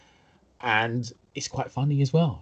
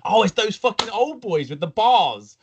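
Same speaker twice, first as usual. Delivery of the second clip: higher-pitched burst of realisation; delighted recognition; 8 semitones higher, 3 dB louder than usual. Retold or imitated. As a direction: act out suddenly remembering who they are. Acting out moment of recognition.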